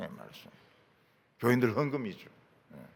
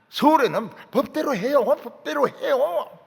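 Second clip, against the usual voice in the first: Seoul dialect quarrel; 7 semitones higher, 5 dB louder than usual. Retold or imitated. Preacher mimicking Seoul churchgoers fighting, Seoul dialect quarrel. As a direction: mimic a Seoul speaker insisting in an argument. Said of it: "서울 사람들은 서울 말로 싸우고".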